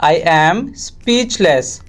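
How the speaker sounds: slow repeat. Shown as a demonstration. Teacher repeating for English learners, slowly segmented. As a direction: draw it out, setting "speechless" apart.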